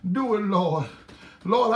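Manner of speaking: ordinary talk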